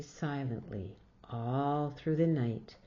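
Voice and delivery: ordinary delivery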